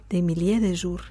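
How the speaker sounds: usual speaking voice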